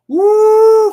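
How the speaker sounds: loud high woo; excited cheer. Real, not acted. Cheering a countdown.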